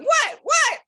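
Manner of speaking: high, loud imitated "what"; mock shocked yelp. Friend driving, told there is big news, mock shocked yelp. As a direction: yelp it in exaggerated disbelief.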